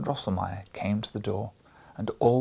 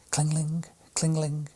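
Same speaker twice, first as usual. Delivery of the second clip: high ringing cling-ling; bell imitation; 6 semitones higher than usual. A narrator's reading. Narrator rings the Christmas bell, high chime.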